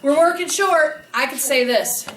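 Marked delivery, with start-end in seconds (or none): 0.0-1.0 louder, higher, slower with a laugh; exaggerated stressed version. Shown as a demonstration. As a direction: ham up the dreaded line. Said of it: "What can I say differently?".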